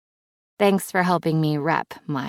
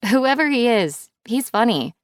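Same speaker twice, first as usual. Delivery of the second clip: higher and louder, playful; joking; 8 semitones higher, 4 dB louder than usual. Acted. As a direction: giggle it out as a joke. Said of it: "she jokes".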